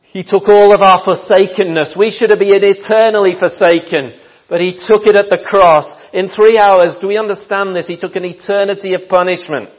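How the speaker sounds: fervent preacher's call; preaching at full force. Acted. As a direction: press the point home.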